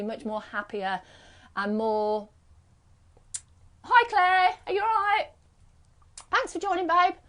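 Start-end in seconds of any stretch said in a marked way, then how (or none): 3.8-7.1 high, chirpy hello; bright high greeting. Real, not acted. Greeting viewer on live stream.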